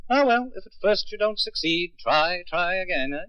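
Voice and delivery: wry resignation. Acted. Resigned jokey quip after arrest.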